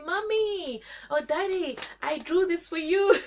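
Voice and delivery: put-on high, excited little child. Child showing mom and dad a drawing, high and eager. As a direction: play a small kid proudly showing a picture.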